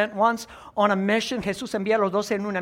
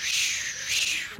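hissing firework sound; unvoiced shh like a rocket; 5 dB quieter than usual. Shown as a demonstration. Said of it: "you're gonna go shh".

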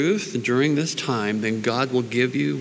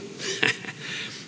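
soft laugh; 7 dB quieter than usual. Real, not acted. Laughs at own joke.

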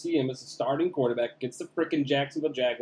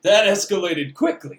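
higher, louder, slower meme delivery; Anchorman quote; 7 semitones higher, 10 dB louder than usual. Retold or imitated. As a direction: quote it like the movie line.

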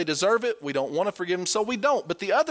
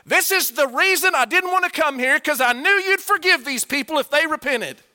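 higher and louder, whining complaint; exasperated Jonah; 9 semitones higher, 5 dB louder than usual. Acted. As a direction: vent like a sulking prophet to God. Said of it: "get the grasp of what Jonah is saying".

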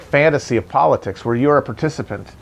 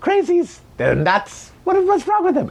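squeaky high mock Trump voice; mocking Trump sneering at climate scientists in a shrill put-on voice; Trump impression. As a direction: do a whiny, much higher Donald Trump gloating about the cold. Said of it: "he will be in that moment then being like".

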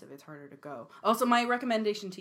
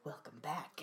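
creepy greeting; 4 semitones lower, 12 dB quieter than usual. Retold or imitated. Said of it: "with the creepy face".

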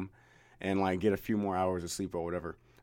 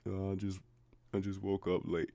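mumbled very softly, like caught out; a groggy, guilty half-mumble to the boss; sheepish mumble; 10 dB quieter than usual. Retold or imitated. Sheepishly telling the angry manager he overslept, very soft mumble. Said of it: "That's exactly how it sounded".

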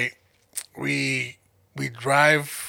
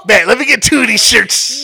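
high, loud blurt through laughter; loud laughing self-quote; laughing shout; 8 semitones higher, 10 dB louder than usual. Retold or imitated. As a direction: shout it out while cracking up. Laughingly acts out his airport purchase.

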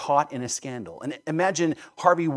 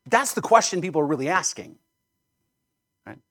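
ordinary delivery

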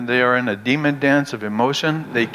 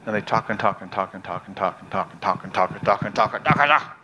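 rapid run-on repetition, building at the end; nonstop chattering; 5 semitones lower, 3 dB quieter, faster than usual. Acted. Preacher mimics people who talk their anger out, nonstop chatter. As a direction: rattle it off like endless talking.

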